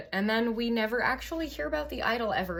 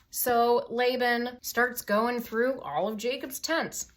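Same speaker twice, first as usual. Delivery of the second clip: ordinary talk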